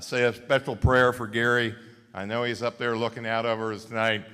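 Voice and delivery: normal talking voice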